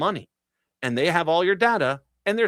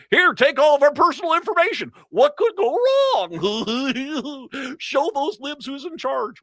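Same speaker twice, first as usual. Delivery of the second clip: high, loud dopey voice, drawn out; hammed-up naive voice; mocking Republican voter; 12 semitones higher, 4 dB louder, slower than usual. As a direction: play the gullible voter handing it all over. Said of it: "That's what".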